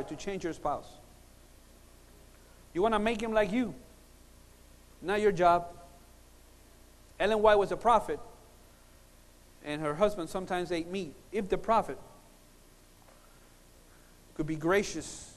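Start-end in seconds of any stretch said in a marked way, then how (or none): none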